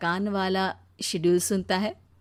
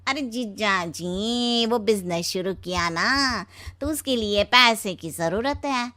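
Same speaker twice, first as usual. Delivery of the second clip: slow, drawling wheedling brother-in-law; Shinu. Acted. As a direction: coax money out of him in a stretched, put-on voice. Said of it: "Sheena का भाई शीनू आ जाता है". (खींचकर फुसलाती बनावटी आवाज़)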